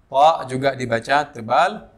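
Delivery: ordinary talk, nothing marked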